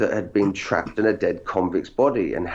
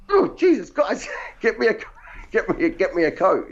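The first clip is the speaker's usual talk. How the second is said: high-pitched cry from sudden cold, half laughing; acted freezing distress; 10 semitones higher than usual. Actor begging crew for a coat after cut, high, cold-shocked yelp. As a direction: act out the shock of the cold hitting.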